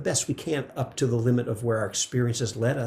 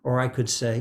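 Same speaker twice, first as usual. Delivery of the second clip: normal talking voice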